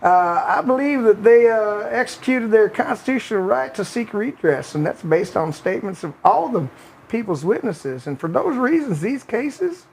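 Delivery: Bill Clinton voice. Retold or imitated. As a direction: read the article as a mild Clinton impression with a Southern accent. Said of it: "Anyways, continuing".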